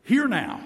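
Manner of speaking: "Hear now" called out high and loud; "Hear now" with force, stress on hear; emphatic. Shown as a demonstration. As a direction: exclaim "Hear now" like a command. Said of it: "there's an exclamation point".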